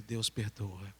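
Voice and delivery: low, soft statement, no pause; gentle answer. Retold or imitated. Boy answering the burdened girl, soft low reply. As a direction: answer quietly, letting the voice fall. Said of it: "E eu disse pra ela".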